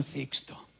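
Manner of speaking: soft unvoiced whisper of the name. Ruined doctor confessing to the pastor, whispered. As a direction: breathe the name out in defeat. Broken man admitting defeat.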